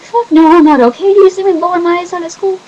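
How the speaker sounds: loud, high, fast outburst; angry retort. Retold or imitated. Answering the teacher who asks if she's okay, loud and angry. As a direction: snap back furiously through tears.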